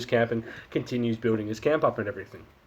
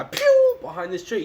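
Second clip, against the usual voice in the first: squeaky yelp; 5 dB louder than usual. Retold or imitated. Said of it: "this Japanese guy".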